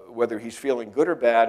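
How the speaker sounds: ordinary talk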